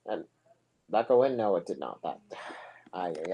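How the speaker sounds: usual speaking voice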